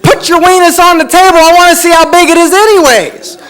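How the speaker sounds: high, loud, relentless holler; drunk stranger. Retold or imitated. Drunk stranger repeating his demand to the comic, hollering. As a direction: bellow it like a stubborn drunk. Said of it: "had a little bit more, you know, drinks".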